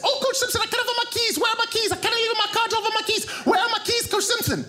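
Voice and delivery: exaggerated high-pitched panic at full tilt; hammed-up frantic impression, quick and very high; frantic Zambian woman. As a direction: imitate her as a rapid, piercingly high babble. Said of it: "which sounded like this".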